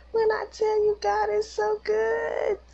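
very high, loud, crying praise; real tearful squeal of relief; tearful gratitude. Real, not acted. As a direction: cry it out in overwhelmed thanks, drawing out the end. Overcome with gratitude, son survived.

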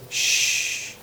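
unvoiced shh; a soft, awed hush. The crowd falling silent before God, soft shh. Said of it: "They said".

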